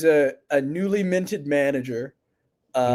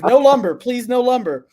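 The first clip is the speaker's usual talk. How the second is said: higher and louder, begging; hammed-up plea, please no more lumber; mock pleading; 6 semitones higher, 5 dB louder than usual. Boss begging the reps after thirty minutes, mock pleading.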